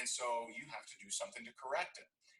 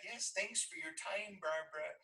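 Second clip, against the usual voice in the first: lightly voiced timid self; meek reply; 7 semitones higher than usual. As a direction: sound small and grateful. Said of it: "Could have just said".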